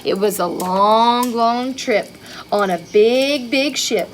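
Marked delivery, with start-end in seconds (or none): none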